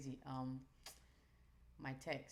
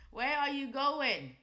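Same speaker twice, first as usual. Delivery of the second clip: higher, louder, slow call after the chart; playful loud call; 9 semitones higher, 14 dB louder, slower than usual. Real, not acted. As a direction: drag it out, calling the chart back. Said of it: "same words twice".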